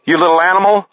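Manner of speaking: high, outraged snarl; furious accusation. A narrator's reading. Jay aiming at cornered Paris, furious. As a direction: spit the insult at a cornered man.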